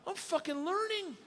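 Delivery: very high, giddy discovery; acted excitement, squeaking upward; thrilled realization. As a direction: marvel at your own progress. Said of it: "I'm like".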